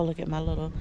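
usual speaking voice

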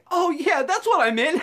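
loud high awkward save; flustered cover-up. Retold or imitated. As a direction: bluff it out loudly, flustered. Covering a botched order.

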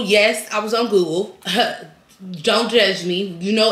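ordinary delivery

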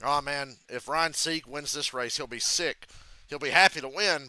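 ordinary talk, nothing marked

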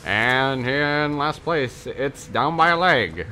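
drawn-out mock race commentary; stretched-out, playful announcer delivery. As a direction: call it like a racetrack announcer, slowly.

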